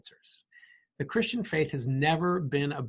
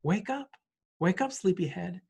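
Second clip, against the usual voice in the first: gentle wake-up; 4 semitones higher than usual. Retold or imitated. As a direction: gently wake a little girl by the hand. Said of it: "gently lifts her up".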